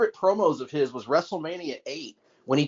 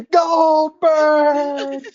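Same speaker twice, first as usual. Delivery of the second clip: cartoon yell; 11 semitones higher, 5 dB louder than usual. Cartoon shout of name.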